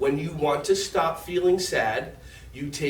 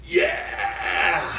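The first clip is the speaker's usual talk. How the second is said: cheer. Real, not acted. Happy pose cheer.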